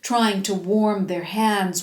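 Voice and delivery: plain reading voice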